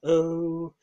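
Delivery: high, whiny mock panic; mocking scared Remainers. Mocking Remainers who fear leaving the EU, in a high whiny voice. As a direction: whimper it like a frightened Remainer mocked. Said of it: "people are too scared to leave the E U".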